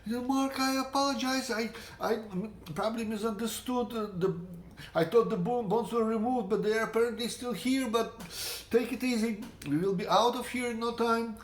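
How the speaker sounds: apologetic friend. Retold or imitated. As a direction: play the friend calming Mark down. The friend's apology voiced in story.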